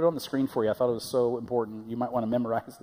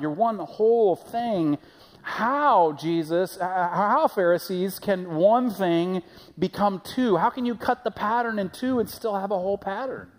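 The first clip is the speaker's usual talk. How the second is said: high, loud, disbelieving challenge; incredulous Jesus; 6 semitones higher, 3 dB louder than usual. Retold or imitated. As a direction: ask it like the idea is absurd. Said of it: "he's asking the Pharisees".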